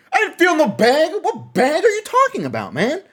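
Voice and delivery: high, loud mock-baffled yell; indignant protest. Storyteller protesting he felt no bag, indignant. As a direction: protest your innocence at top pitch.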